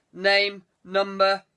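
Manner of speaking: loud, curt, clipped-off demand; snapping demand. Acted. Lord loses patience with the superintendent, loud curt demand. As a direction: bark each word like an order. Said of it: "Norwood's patience snapped".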